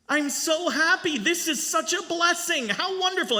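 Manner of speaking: put-on boy thrilled with his brand new tie; overjoyed boy voice. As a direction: squeal it like a thrilled child.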